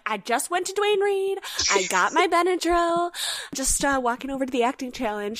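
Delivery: high, chirpy and carefree; breezy Camille. Retold or imitated. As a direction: bounce in without a care, voice up high. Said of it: "she's like".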